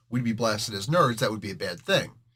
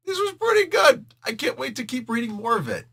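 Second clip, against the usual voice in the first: much higher, delighted reader voice; mock delighted reader; 10 semitones higher than usual. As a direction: gush like a thrilled fan finishing the book. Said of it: "not go".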